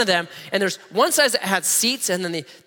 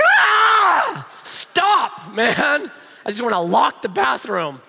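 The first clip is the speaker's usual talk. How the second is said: high, loud mock exasperation, fading down; fed-up yelp at passengers then grumble; exasperated outburst; 6 semitones higher, 4 dB louder, much slower than usual. Fed up with bathroom traffic on a long flight, mock exasperated yelp. Mocking bathroom line crowd.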